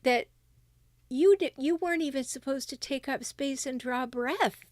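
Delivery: normal talking voice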